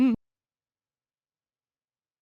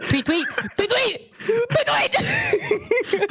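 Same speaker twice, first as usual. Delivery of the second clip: cartoon bird voice; 14 semitones higher, much slower than usual. Player chirps as bird.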